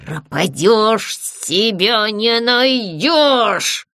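very slow incantation; casting a spell. Acted. Ghost curses the puppet, slow and chanting. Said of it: "шорох из слов заклятия".

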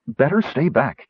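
usual speaking voice

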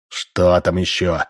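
ordinary delivery

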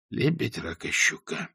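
breathy weary sigh. A narrator's reading. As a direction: sigh the words out, barely voiced. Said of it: "вздохнул отец".

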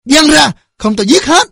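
loud, high, furious warning; enraged husband's shout; roaring threat. A narrator's reading. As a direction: bellow a threat in rage. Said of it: "Ông Thiện gầm lên".

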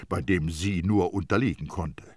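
ordinary delivery

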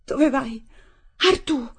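pleading call from the top of the stairs; breathless calling. Acted. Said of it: "con affanno".

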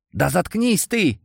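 high-pitched irritated snap, no shouting; exasperated outburst. A narrator's reading. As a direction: lose patience, pitch leaping up. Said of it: "не выдержала Агнетта".

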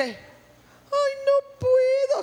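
very high squeaked whine; effeminate little voice. Retold or imitated. Preacher imitating the man asked to copy the hoarse voice, high effeminate whine. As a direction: imitate him giving up in a tiny high voice. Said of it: "A ver, arremédalo".

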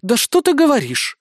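put-on high, challenging girl's voice; girlish voice. A narrator's reading. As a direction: voice a young woman cutting in. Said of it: "раздался знакомый девичий голосок".

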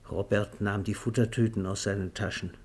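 ordinary talk

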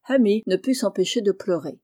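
ordinary delivery, nothing marked

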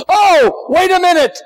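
high, loud mock panic; flustered alarm at losing your fire. Believer realizing he has backslid, loud high panic.

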